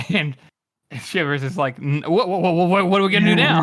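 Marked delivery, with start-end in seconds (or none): 1.8-3.6 quick, louder stuttering mock panic; rattled, rapid-fire stammer, played for laughs; flustered stammer. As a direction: trip over the repeated word in a playful panic. Acting panicky Shivers, fast stammer.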